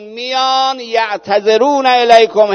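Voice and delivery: الیکم drawn out, slow and loud; slow emphatic repeat. Shown as a demonstration. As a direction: hammer the verse home slowly. Repeats verse slowly for emphasis. (تکرار آهسته و مؤکد الیکم)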